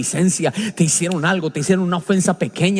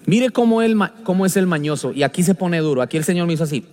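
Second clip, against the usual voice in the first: ordinary talk, nothing marked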